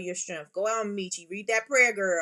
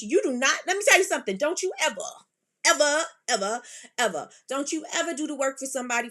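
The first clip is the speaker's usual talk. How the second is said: sudden high, loud warning, ever repeated; emphatic scolding; 6 semitones higher, 5 dB louder, faster than usual. Real, not acted. To Michi about working before payment, loud scolding. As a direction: hammer it home like a stern big sister.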